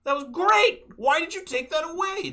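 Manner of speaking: loud, very high-pitched outcry; hammed-up indignant shouting; exasperated protest. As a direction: wail it at whoever took it away. Venting at the game's developers.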